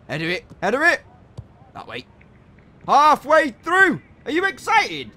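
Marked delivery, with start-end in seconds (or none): 0.6-1.0 Header it yelled high and loud; shouted command. Real, not acted. Gamer yelling at his striker, loud and high. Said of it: "repeats it louder". 2.9-5.1 excited. Real, not acted. Excited commentary.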